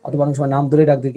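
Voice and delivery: ordinary talk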